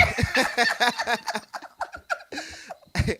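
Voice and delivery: long, loud, high laugh; real laughter. Real, not acted. Interviewee after singing a jail song, long high laugh. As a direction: crack up at your own singing.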